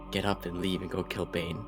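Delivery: ordinary delivery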